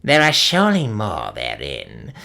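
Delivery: low, far-off croak of a hag; croaking old witch. A narrator's reading. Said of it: "croaked Gagul".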